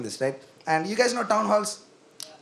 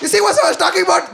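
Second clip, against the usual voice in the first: usual speaking voice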